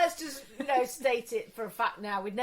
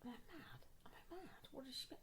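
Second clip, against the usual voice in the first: whispered, much softer, puzzled to herself; confused whisper; 5 semitones higher, 20 dB quieter than usual. Retold or imitated. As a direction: mutter it under your breath, baffled. Confused friend wondering to herself.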